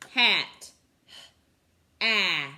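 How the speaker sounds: Hat said loud and drawn out; word stretched and stressed. Shown as a demonstration. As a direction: punch out Hat clearly for the class. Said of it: "what makes the sound".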